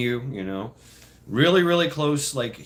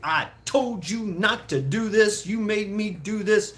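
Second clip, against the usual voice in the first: raised-pitch retold telling-off; scolding mother; 6 semitones higher than usual. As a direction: scold word by word, imitating mom mid-spanking. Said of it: "and talking to you".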